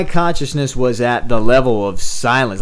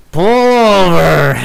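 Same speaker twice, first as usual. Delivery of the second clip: put-on slow, drawn-out, louder and higher drunk command; insistent drunk friend; 6 semitones higher, 5 dB louder, much slower than usual. Drunk Brian hitting the driver's arm again, slow insistent demand. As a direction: stretch it out like he means it now. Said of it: "he hits me again and he's like".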